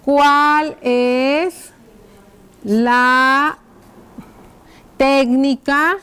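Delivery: drawn-out words with pauses; writing-out dictation. Shown as a demonstration. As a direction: dictate slowly, leaving time to write. Dictates while writing the question out. (dictado lento, alargando las palabras)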